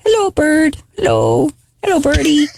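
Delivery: put-on high sing-song baby talk to a pet; sweet high pet-talk greeting; cooing at a bird. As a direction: coo it like talking to a parrot.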